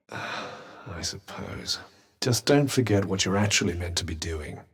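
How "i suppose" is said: slow, soft, sighed concession; low, drawn-out grudging agreement; reluctant sigh. Acted. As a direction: give in wearily on a long sigh. Gives in reluctantly.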